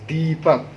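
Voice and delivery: दीपक said softly with weight on the long दी; stressed demo. Shown as a demonstration. Teacher showing pupils the long दी in दीपक. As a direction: lean into दी in दीपक. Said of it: "जैसे ही आप दीपक बोलते हैं".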